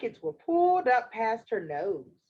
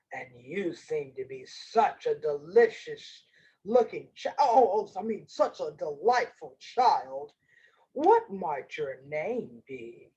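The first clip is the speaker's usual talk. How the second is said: low, slippery wolf voice; sly wolf; 9 semitones lower than usual. Acted. Wolf flatters the child he wants to eat, low sly voice. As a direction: flatter her in a low voice, catching the slip.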